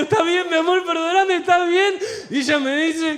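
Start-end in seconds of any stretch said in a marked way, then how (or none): 0.0-1.9 high-pitched, pleading whimper; acted shaken, upset apologising; tearful apology. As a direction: plead with your girlfriend through mock tears. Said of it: "Yo estaba temblando, llorando, moqueando".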